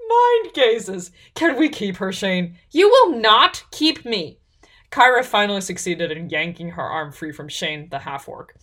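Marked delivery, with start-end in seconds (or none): 0.1-2.6 high, loud, delighted outburst; loudly amused. A narrator's reading. Elf tickled by the stranger's cheek, loudly amused. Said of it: "Risa laughed loudly". 2.7-4.4 high, loud, slow refusal; indignant. A narrator's reading. Heroine objecting to being kept, indignant.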